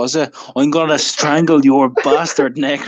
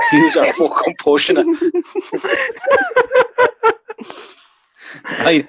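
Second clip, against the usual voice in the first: mock rage squeaking high; voice cracking high. Mock fury breaking.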